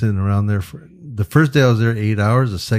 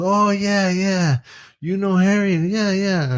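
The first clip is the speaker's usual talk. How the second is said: much higher, excited actor impression; Al Pacino; 11 semitones higher, slower than usual. Actor hearing about Harry's birthday, high-pitched eager impression. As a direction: answer high and eager, mimicking the star. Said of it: "He goes".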